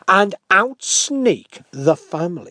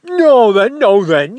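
louder, bluff greeting; loud ogre; 5 semitones higher, 11 dB louder, slower than usual. Acted. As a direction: bellow through the door. Ogre bangs on door.

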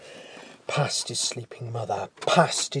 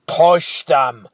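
louder, hard cold order; icy command; 3 semitones higher, 11 dB louder than usual. Acted. Giant repeating his order, cold and forceful. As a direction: say it like ice, no patience left. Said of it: "The giant's voice was colder than the night".

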